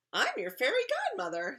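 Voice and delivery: high, loud little-man voice; fairy godmother figure. Acted. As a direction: announce yourself grandly. Little man introduces himself.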